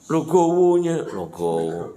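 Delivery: slow sarcastic praise; mock admiration. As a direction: feign awe at such acceptance. Sarcastic praise of the forgiving husband.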